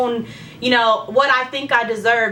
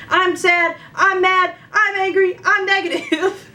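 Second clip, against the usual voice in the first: high, whiny declarations, slower at the end; pitched-up mock despair; upset venting; 7 semitones higher, slower than usual. Venting to friends on the phone, high upset whine. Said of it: "boohoo crying".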